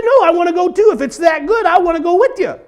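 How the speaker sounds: husband begging his wife; whiny insisting. Retold or imitated. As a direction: beg to come along. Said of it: "Well, I said".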